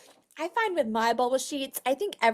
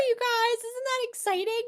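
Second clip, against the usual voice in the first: high, bright-eyed hype for the team; excited; 11 semitones higher than usual. Real, not acted. Host hyping her team about gold medal earnings, excited. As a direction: sell the thrill of it.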